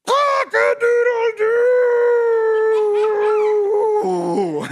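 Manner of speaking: very long, high, loud crow; triumphant full crow. A narrator's reading. Rooster finally manages to crow, huge drawn-out crow. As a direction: belt it out and hold the last note. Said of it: "And finally".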